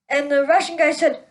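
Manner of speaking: ordinary delivery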